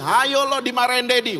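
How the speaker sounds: teasing big sister. Retold or imitated. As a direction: needle the little brother. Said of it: "kakaknya intimidasi lagi". (kakak mengejek adiknya, nada lebih tinggi)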